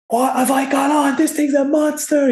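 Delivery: high-pitched shout held down in volume; mock excited shouting. Angler reliving the moment he hooks a monster, mock shouting. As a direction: imitate an angler yelling over a huge catch, pitch way up. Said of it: "you just start shouting, roaring".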